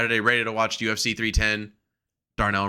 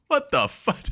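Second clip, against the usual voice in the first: higher, stunned outburst; mock astonishment; 10 semitones higher than usual. Fan watching Oba throw people, stunned. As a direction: react to a wrestler swatting people. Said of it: "you go".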